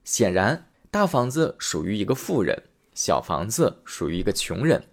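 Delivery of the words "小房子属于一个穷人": ordinary delivery, nothing marked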